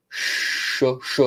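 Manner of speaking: Sh, voiceless with the tongue back mid-mouth; a deep hushing Sh; deep sh demo. Shown as a demonstration. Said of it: "a little deeper sound".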